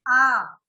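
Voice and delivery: a long open आ; open vowel demo. Shown as a demonstration. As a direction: show the class a wide-mouthed आ. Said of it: "आ बोलिए".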